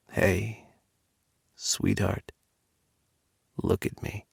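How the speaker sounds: soft, hushed and tender; a lover gently comforting his partner; gentle endearment. Acted. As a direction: coax him to open his eyes. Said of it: "At the gentle endearment".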